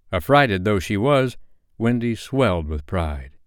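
ordinary delivery

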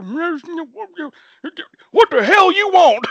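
high, very slow grumbling geezer; muttering old man. Retold or imitated. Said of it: "he comes out just muttering".

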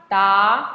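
rising "da"; second tone. Shown as a demonstration. Teacher running through four tones on one sound, "da" rising. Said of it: "all four tones in one go".

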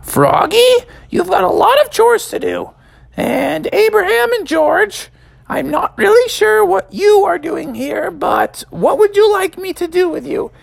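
very high mother voice; high polite mom; polite mother frog. Acted. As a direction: ask politely in mom's voice. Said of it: "she said".

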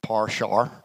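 a teasing imitation of Paul's accent saying Par shower; mock accent. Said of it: "worse than Paul saying".

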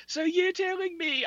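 very high, slow mock British voice; attempted British accent. Host quoting a British atheist woman, high mock British accent. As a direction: drag it out in a failed British accent. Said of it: "I can't do it".

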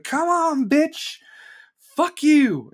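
high, loud mock-furious yell; angry challenge. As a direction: scream the dare at the bully. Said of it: "I was like".

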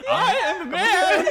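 high, slow laughing whimper; cringing submission, hammed up. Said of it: "The whimpering voice".

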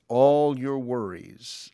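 slow, drawn-out all in all your worries; stress on all. Shown as a demonstration. Pastor stressing the scripture's word to congregation, slow emphasis. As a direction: lean hard and slow into all. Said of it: "I want to emphasize the word all".

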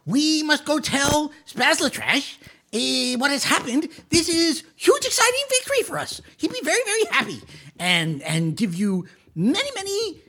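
a touch of a high, eager little kobold; Keegle's voice. Acted. The DM as Keegle to the players, lightly voiced eager kobold. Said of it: "Keegle is like".

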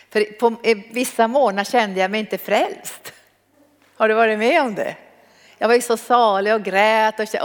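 ordinary talk

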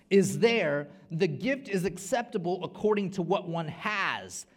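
loud, high, proclaiming; preacher preaching the text; forceful scripture reading. Acted. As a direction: proclaim the verse.

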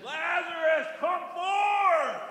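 high, ringing call into the tomb; Jesus shouting Lazarus out of the grave; commanding shout. Acted. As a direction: cry out to raise the dead. Voicing Jesus calling out.

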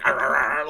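loud growling noises; imitated growling. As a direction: growl like a chomping clone. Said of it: "And he's just like".

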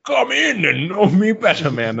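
high, inviting creepy voice; mock Ghost of Christmas Present beckoning. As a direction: lure the kid in, uncanny and high. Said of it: "he's just like".

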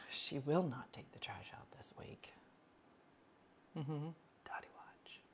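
hushed whisper; conspiratorial whisper. Real, not acted. Telling listeners about Dottie's trash, whispered. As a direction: share a secret in a whisper.

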